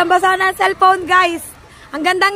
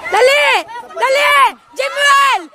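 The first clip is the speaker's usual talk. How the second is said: higher-pitched, hurrying shouts; short, raised sideline yells; urgent calling out; 4 semitones higher, slower than usual. Real, not acted. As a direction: call a player to hurry up. Urging a player on.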